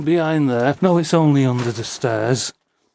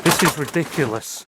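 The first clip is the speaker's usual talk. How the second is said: ordinary talk, nothing marked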